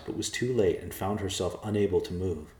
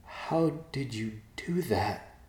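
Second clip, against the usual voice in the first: horrified; 6 semitones higher, slower than usual. Acted. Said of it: "looked at him in horror".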